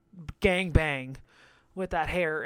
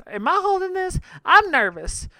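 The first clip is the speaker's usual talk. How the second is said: nervous quote; 11 semitones higher, 8 dB louder than usual. Retold or imitated. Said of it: "And she says".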